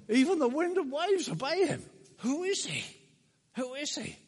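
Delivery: high-pitched, astonished cry; awestruck exclamation. Acted. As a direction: blurt it out in stunned amazement. Disciples' astonishment acted out.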